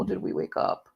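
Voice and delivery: quiet, gentle question; soft puppy talk. Real, not acted. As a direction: ask softly of a waking puppy. First question to waking puppy.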